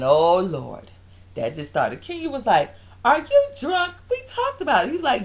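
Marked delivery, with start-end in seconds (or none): 3.0-4.9 high-pitched incredulous retort; indignant mock outburst. Retelling Kenya confronting her boyfriend, indignant. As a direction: imitate an outraged girlfriend, voice shooting up.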